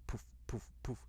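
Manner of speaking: soft, airy poofs; imitated smoke puffs. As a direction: puff out each one like train smoke. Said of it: "the smoke kind of poofing out".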